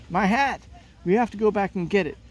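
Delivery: high, loud, rushed alarm; a boy panicking over his hat; upset about lost hat. Acted. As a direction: blurt it out as the hat flies off. Hat blown into the water.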